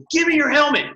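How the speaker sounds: mock coach barking the order, pitched high and loud; a retold order snapped out at a player; coach's command. Coach demands a player's helmet, in a loud, high mock bark.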